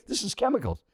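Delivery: high-pitched mock indignation, not shouted; retold scolding. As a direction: imitate a stranger denouncing the food. Acted stranger scolding his food.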